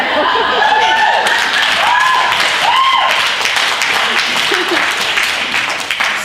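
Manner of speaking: a long, high, loud burst of laughter; delighted loud laugh. Real, not acted. At the end of her healing story, a delighted loud laugh. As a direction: let joy explode in a big laugh.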